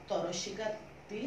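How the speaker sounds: ordinary delivery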